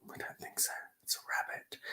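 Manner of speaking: very soft shy whisper. Acted. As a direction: breathe it out shyly. Rabbit whispers.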